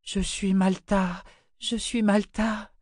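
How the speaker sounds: slow, high, dazed moan; high, drawn-out whimper; moaning mumble. Acted. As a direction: repeat it weakly, as if barely conscious. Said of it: "elle gémit et se mit à marmonner".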